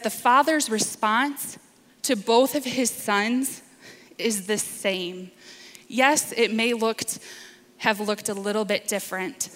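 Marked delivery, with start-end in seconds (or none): none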